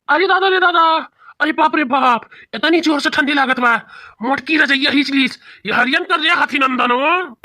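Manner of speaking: high wailing moan from the cold; whining from cold. Acted. Husband freezing because wife took the quilt, high whining wail. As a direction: wail as if frozen to the bone.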